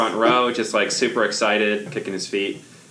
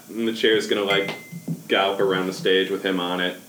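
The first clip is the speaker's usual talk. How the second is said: normal talking voice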